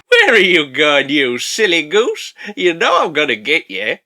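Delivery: slow, put-on playful menace; teasing pursuer voice. Pursuer taunting the fleeing man, slow mock menace. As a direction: toy with your prey, drawing out the words. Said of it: "with his pursuer like".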